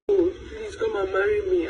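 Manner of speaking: ordinary delivery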